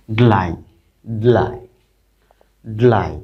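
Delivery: ড্লাই as one syllable, dlai, falling each time; pronunciation demo. Shown as a demonstration. Teacher drills D-L-I for students, pronunciation demo. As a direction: repeat ড্লাই separately, d sounded before lai. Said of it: "ডিটা উচ্চারণ হবে কিন্তু ড্লাই".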